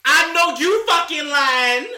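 high, loud, slow drawn-out shout; disbelieving yell. Real, not acted. Repeats disbelief louder.

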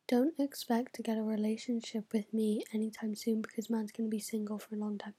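soft conspiratorial whisper; whispered aside. Real, not acted. Vlogger whispering to viewers that she'll stay single. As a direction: confide it in a whisper.

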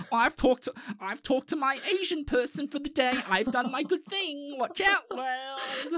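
slowed, grandly smug sign-off; mock smug. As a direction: parody her smug good deed. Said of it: "It's like".

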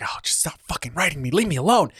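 fed-up plea rising in pitch; mock exasperation. Imagining the princess having a day on getting the letter, mock exasperation. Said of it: "like, having a day".

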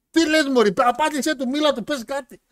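high, loud burst of indignation; exasperated shout. Acted. Podcaster berating a passive wife, exasperated shouting. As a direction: yell at her to finally speak up.

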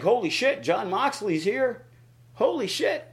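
imitating a shocked fan; stunned disbelief. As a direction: gasp it out in total shock. Fans' shock retold.

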